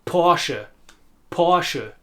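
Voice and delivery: "Porsche" in two syllables with a short final e. Shown as a demonstration. Teacher modelling the brand name, two syllables. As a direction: sound the e ending on "Porsche". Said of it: "it also has two syllables".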